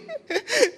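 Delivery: a short high burst of laughter. Real, not acted. Laughing at herself before the congregation. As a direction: let a squeaky laugh slip out.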